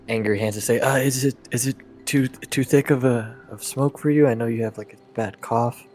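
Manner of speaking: ordinary talk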